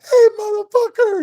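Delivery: high-pitched mock yell; imitated yelling. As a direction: holler it like the rowdy guy. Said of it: "He starts yelling".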